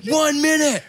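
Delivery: high, called-out countdown; mock urgent announcement; announcing. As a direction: imitate the crew calling time. Retelling crew countdown.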